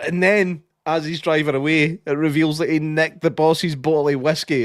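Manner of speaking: thrilled storytelling; excited. Real, not acted. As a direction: rush it out like the best bit yet. Building to the best reveal.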